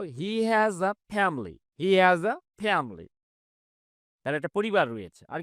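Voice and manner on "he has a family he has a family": "He has a family" spoken very slowly, every word clear; slow classroom demo of the sentence; model pronunciation. Shown as a demonstration. Teacher to students, showing how to say it very slowly. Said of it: "এভাবে আমরা বলবো".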